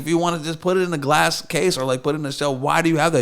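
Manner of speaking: high, loud arguing; exasperated challenge. Retold or imitated. Guest retelling his argument over the guitar, exasperated. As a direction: press the owner with exasperated disbelief.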